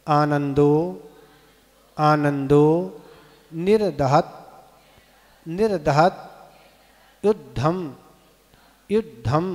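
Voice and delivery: each word said separately, measured and deliberate; pronunciation demo. Shown as a demonstration. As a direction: sound out the Sanskrit for pupils to repeat. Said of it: "इसका उच्चारण करें". (एक-एक शब्द का स्पष्ट उच्चारण)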